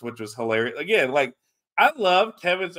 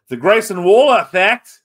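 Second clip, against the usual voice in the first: put-on Australian accent, higher and louder; amateur Australian accent; 7 semitones higher, 10 dB louder than usual. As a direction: have a clumsy go at Australian. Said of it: "more practice with the Australian accent".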